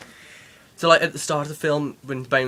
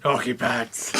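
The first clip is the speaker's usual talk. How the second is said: grumbly Batman voice; slower than usual. Retold or imitated. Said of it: "Batman with his grumbly voice like".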